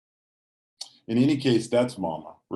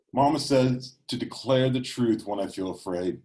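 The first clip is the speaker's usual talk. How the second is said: plain reading voice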